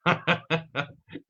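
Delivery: slow, laughing through the words; real amused laugh at the glitch. Real, not acted. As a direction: chuckle while admitting the blunder.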